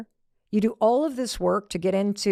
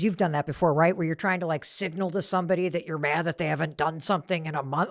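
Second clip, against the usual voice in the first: ordinary delivery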